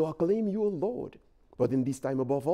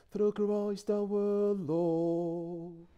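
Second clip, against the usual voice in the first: cathedral echo; 3 semitones higher, much slower than usual. Real, not acted. Chanted liturgical dialogue, reverberant.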